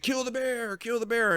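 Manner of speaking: high-pitched imitation of a mob; mock crowd cry. Mimicking everybody when the bear attacks, mock crowd cry. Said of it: "everybody's like".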